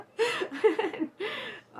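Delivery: high squealing laugh; a helpless high-pitched laugh. Real, not acted. Laughing fit.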